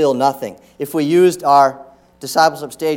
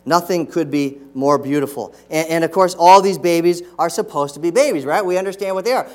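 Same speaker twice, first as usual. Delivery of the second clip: normal talking voice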